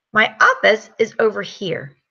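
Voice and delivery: usual speaking voice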